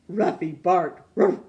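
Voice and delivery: loud imitated dog bark. A narrator's reading. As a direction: give a short loud Ruff. Dog barks in story.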